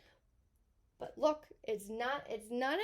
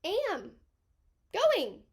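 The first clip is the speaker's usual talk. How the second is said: imitating a confused student; 5 semitones higher, slower than usual. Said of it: "you're like".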